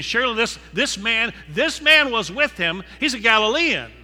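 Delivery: insistent accuser. Retold or imitated. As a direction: jab the accusation home. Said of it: "he kept insisting".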